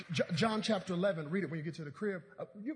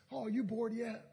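ordinary talk

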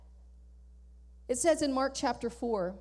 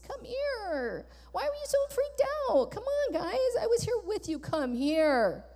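much higher, sing-song mock sympathy; mock coddling; 7 semitones higher than usual. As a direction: coo it up high like soothing a toddler. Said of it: "I'm being a little facetious here".

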